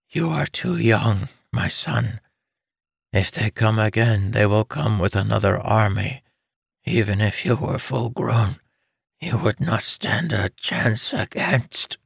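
lightly voiced pleading mother; weak urgent mother. Dying mother urging her son to leave, weak. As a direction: urge him though strength fades.

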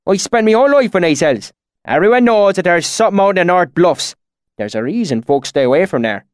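high, loud country dialect easing at the end; irritated rustic swineherd. A narrator's reading. Swineherd warning about the bluffs, irritated and rustic. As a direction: insist like an offended bumpkin.

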